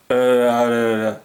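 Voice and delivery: low, droning gibberish; unintelligible phone agent. Retold or imitated. Agent answers the order number, low droning gibberish. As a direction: mumble nonsense in a low monotone. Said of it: "and the person went".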